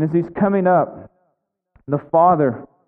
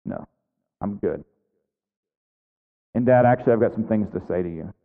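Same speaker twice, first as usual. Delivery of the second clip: low, quiet acted sulk; pouting son; 7 semitones lower, 4 dB quieter, faster than usual. Older brother answering his pleading father, low sullen refusal. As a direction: refuse quietly, voice dropped right down. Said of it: "he's pouting".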